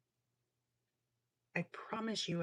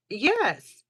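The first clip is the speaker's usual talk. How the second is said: excited yes; 6 semitones higher, 11 dB louder than usual. Real, not acted. Eager agreement.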